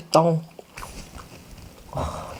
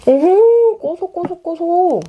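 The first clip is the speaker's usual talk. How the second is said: excited delight; 9 semitones higher, much slower than usual. Real, not acted. Delighted tasting reaction.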